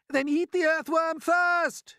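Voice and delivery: high, loud, drawn-out centipede shout. A narrator's reading. Centipede offers the earthworm to the attacker, shouting. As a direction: shout it slowly at the top of the voice. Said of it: "shouted the centipede".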